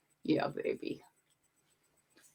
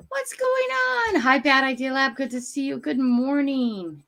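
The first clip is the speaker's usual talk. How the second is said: much higher, slow sing-song hello; greeting live viewers in a drawn-out sing-song; 6 semitones higher, 4 dB louder, slower than usual. Real, not acted. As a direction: stretch out a cheery welcome.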